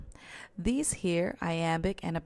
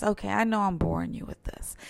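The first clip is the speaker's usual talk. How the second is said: normal talking voice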